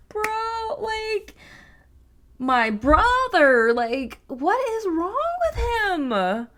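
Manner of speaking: high, incredulous outburst; disbelieving bro. Real, not acted. Reacting to the narrator's eye, high incredulous complaint.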